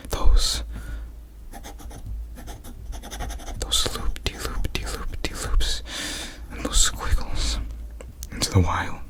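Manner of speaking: hushed whisper telling a tale; ASMR narration whisper; whispered storytelling. Acted. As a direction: keep the story in a soft whisper.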